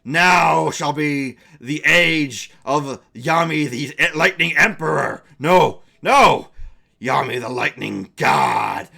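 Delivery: slow, loud, grand villain proclamation; gloating villain. Acted. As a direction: crown yourself a god, slowly. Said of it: "He's like".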